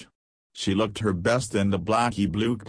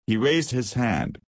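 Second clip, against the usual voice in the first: ordinary delivery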